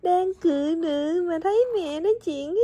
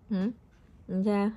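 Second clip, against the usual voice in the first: ordinary delivery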